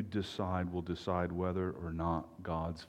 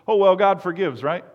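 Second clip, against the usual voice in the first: imitating a friend's nervous, much higher and louder laugh-line; nervous chuckle; 11 semitones higher, 14 dB louder than usual. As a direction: brush it off with an uneasy giggle, pitched way up. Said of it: "they sort of nervously chuckled".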